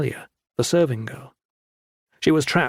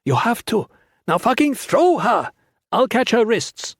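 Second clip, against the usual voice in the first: urgent shout; 10 semitones higher than usual. A narrator's reading. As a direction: demand it across the gap. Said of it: "he shouted back".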